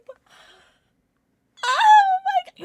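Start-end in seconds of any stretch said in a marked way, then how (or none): 1.6-2.6 very high, loud, slow gush; thrilled exclamation. Real, not acted. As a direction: gasp it out in delight. Pet changes colour.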